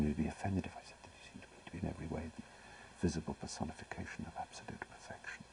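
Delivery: a fast, hushed whisper to himself; whispering Algernon's line as if replaying it inside his head. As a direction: murmur the line under the breath, savouring it. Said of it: "I replayed it in my brain".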